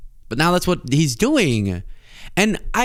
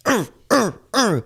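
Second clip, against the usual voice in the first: mock dying groans; 9 semitones higher than usual. Stabbed victim groaning.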